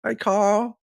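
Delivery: high-pitched llama greeting; imitated llama. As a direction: mimic the cartoon llama's call. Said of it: "every time I go".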